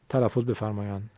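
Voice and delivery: ordinary talk, nothing marked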